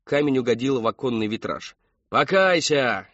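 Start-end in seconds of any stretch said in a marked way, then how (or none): 2.1-3.1 loud, high, drawn cry; crowd's echoing shout. A narrator's reading. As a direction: take up the chant at full voice. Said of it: "эхом подхватили остальные".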